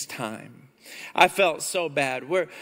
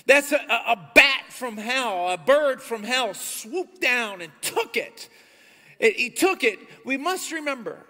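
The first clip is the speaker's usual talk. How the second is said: preaching at full force; 5 semitones higher than usual. Acted. Pastor preaching about the bird from hell, forceful. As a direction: drive it home to the congregation.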